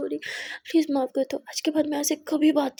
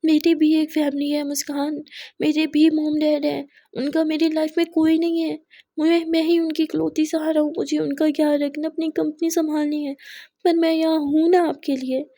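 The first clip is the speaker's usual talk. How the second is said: loud, raised, higher heated rant; angry outburst; 4 semitones higher, 8 dB louder than usual. Acted. Aadhya loudly venting at Muskan about family duties. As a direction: let the frustration burst out at her. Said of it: "आप गुस्सा क्यों कर रहे हो?".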